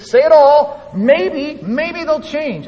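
God calling out high and loud to his prophet; urgent shout as God. Acted. God urges Jeremiah to speak everything, in a high loud call. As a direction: shout the command, then hang on the hope.

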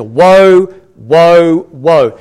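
loud, stretched cries of warning; loud proclamation. Acted. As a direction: echo the angel, each word ringing out. Echoes the angel's loud warning.

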